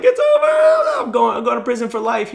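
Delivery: very high shouted mock despair. Teen to officer, sure he's going to prison, shouted mock despair. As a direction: wail it like life is ending, hammed up. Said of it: "I'm like".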